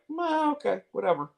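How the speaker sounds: high, offhand mock indifference; dismissive shrug. Not hearing from a friend for a month, dismissive shrug. Acts out his former indifference.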